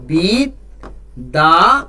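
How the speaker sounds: বিদ্যালয় very slow, split as বিদ্ / the / লয় with pauses; spelled-out syllables. Shown as a demonstration. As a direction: sound out বিদ্যালয় one syllable at a time. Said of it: "বানান করে কিংবা Banglish করে".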